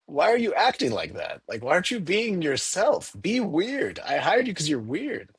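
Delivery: mock-baffled boss asking staff to be weird; baffled urging. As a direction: boss begging his staff to be themselves, in mock disbelief. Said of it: "that I'm like".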